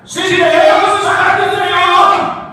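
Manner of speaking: high, loud rallying shout; battle cry. Acted. Preacher voices Gideon's men attacking the camp, shouted battle cry. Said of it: "a gente vai entrar e vai gritar".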